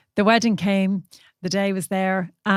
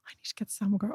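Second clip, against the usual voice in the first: very soft, hushed plea; retold urgent whisper; 11 dB quieter, faster than usual. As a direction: whisper it so the speeches aren't interrupted. Acts helper not wanting to interrupt speeches.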